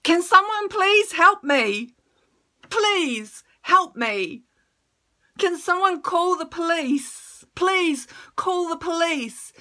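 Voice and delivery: pleading loud and high, begging strangers; desperate cry for help. Retold or imitated. Beaten on the street, begging passers-by for help. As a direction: call out for help, frantic and loud. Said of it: "and I'm going".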